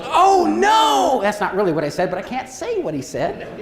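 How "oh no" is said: high, loud cry of horror; sudden panicked yell from the ground; alarmed shout. Retold or imitated. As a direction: instructor sees the danger and yells. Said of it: "the instructor goes".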